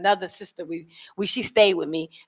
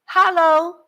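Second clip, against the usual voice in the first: high, put-on Hello with an open a; Hello said hah-lo in an African lilt; local hello; 10 semitones higher, 5 dB louder than usual. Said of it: "So me, I say".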